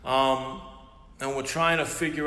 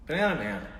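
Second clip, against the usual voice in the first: usual speaking voice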